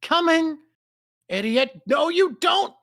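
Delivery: high, loud comeback; acted battle retort. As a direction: shout it back in mid-fight.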